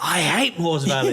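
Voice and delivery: slow, loud, laughing mock whine; hammed-up teenage groan of disgust; fed-up daughter. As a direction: imitate a child who is sick of the place. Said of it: "And she's like".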